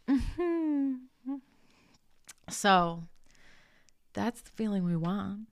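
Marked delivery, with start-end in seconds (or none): 0.1-0.9 high, sliding "mmm" of pleasure; pleasured hum. Acted. Cook showing how cooking makes her feel, pleasured hum. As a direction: hum it high and let it slide down. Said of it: "cooking makes me feel".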